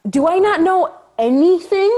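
can't believe she got the part; incredulous disbelief. Retold or imitated. After getting the Grey's Anatomy call, incredulous cry. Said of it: "And I was like".

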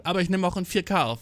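ordinary talk

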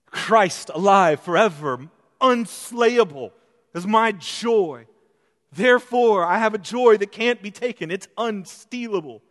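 high, loud preaching build; fervent preaching. Acted. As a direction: preach it out to the congregation. Preacher declaring climax.

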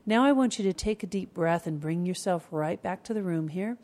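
normal talking voice

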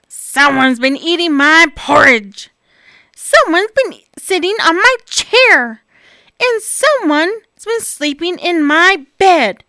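loud, booming bear growl; big bear voice. Acted. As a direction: roar it out like a grumpy bear. Bear finds his voice, booming.

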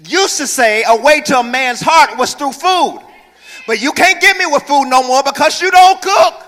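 high, loud, hammering preacher; preaching at full force. Real, not acted. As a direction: drive it home to the congregation.

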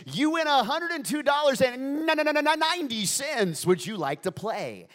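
loud, hyped radio game-show host; big booming radio announcer patter. Acted. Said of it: "I'd put on my D J voice".

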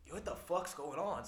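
loud, slow, startled question. Retold or imitated. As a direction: call out confused and loud. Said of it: "And I'm like".

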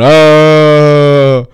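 mock the breathy defense yell; mock calm oh. A kidnap victim mimicking the defense yell, mock oh. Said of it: "is going".

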